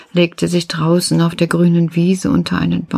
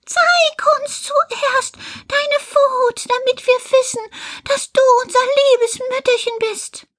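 put-on children's voices. Little goats shouting through the door at the wolf, very high childlike voices. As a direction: pipe it up high like frightened little kids refusing.

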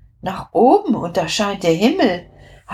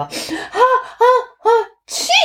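sneezing; faster than usual. Acted. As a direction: hold the ha-ha-ha, then sneeze. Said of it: "so wie ich das jetzt auch mache". (Niesen mit Anlauf)

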